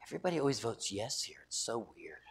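hushed gossip. Retold or imitated. Newcomer lady telling the pastor about votes, hushed. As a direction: confide it in a near whisper. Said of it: "She goes".

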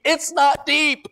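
high, loud preacher's climb; full-force preaching. Acted. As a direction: build the sermon to a peak.

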